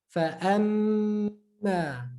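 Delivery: Fa amma with a long hummed mm; prolonged meem. Shown as a demonstration. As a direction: hold the nasal mm in amma. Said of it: "Prolong that nasal sound".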